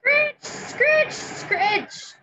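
high, rasping scritch noises; raking sound effect. Acted. Story reader mimics Hippo raking leaves, scratchy sound effect. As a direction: imitate a rake scraping through leaves.

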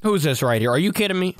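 normal talking voice

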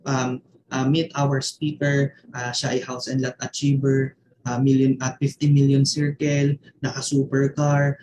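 low, lifeless read-out of a script. Shown as a demonstration. Coach showing how not to introduce a webinar speaker, lifeless. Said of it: "Alam niyo, walang kabuhay-buhay".

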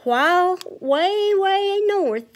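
lilting read-aloud rhyme; sing-song story time voice; storybook reading. Acted. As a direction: read it like a picture book to kids. Reads rhyming story to children.

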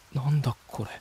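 breathless hushed wonder; voiceless gasp of awe; awed whisper. Real, not acted. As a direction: whisper it in amazement. Awed by glowing stones.

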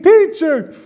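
high joyful cry; Mary's cry. Acted. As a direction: call out to him in overwhelmed joy. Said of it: "She goes".

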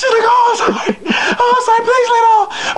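mock tearful falsetto pleading; imitated frantic apology, squeaky and high; crying woman. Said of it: "she's still over me crying".

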